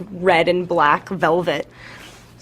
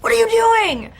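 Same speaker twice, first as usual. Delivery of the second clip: high, pitched-up imitated alarm; mock alarmed cry; 3 dB louder than usual. Actress imitating herself catching dad watching her film, mock-alarmed. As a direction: burst in playfully horrified. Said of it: "I'll run into the room and be like".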